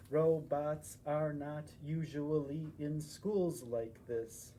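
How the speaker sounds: plain reading voice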